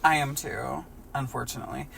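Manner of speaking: ordinary talk, nothing marked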